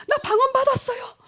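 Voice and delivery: high, loud tearful announcement; imitated tearful teen. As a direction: act out a child crying out the news. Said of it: "눈물을 흘리면서". (울먹이며 소리치는 아이 흉내)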